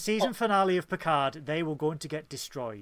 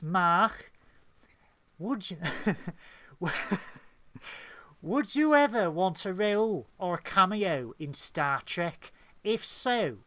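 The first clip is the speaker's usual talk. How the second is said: put-on Liverpool accent; 3 semitones higher, slower than usual. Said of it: "ask this question in a Liverpool accent".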